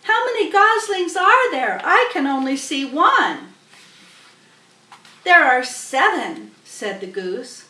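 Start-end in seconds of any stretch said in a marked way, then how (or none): 0.0-3.5 high, loud eager pig voice; Wilbur's voice. Acted. Wilbur asking the goose, high and eager. 5.2-6.5 louder goose voice; proud goose answer; goose's voice. Acted. Goose answering Wilbur, loud and proud.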